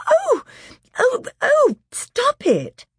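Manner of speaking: very high, loud panicked cries; terrified girl being pulled. Acted.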